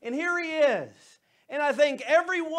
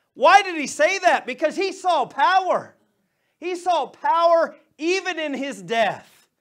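louder, higher, driving preaching; fiery preaching; 3 semitones higher, 7 dB louder than usual. Real, not acted. Pastor proclaiming to the congregation, loud and fervent. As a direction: preach it home with power.

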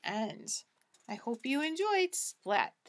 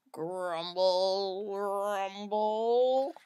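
deep rumbling grumble; low grumble; 6 semitones lower, much slower than usual. Acted. Furnace grumbles in the cellar, deep rumble. Sound effect voiced.

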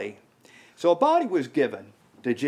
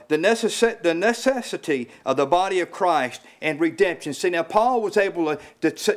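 ordinary talk, nothing marked

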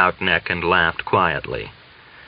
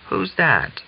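quiet alarmed; 10 semitones higher than usual. A narrator's reading. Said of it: "sounded quietly and a little alarmed".

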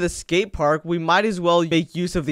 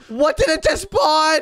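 alarmed shout; 9 semitones higher, 4 dB louder than usual. Acted. Monster suddenly spawns, calls out.

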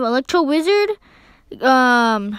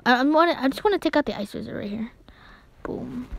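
usual speaking voice

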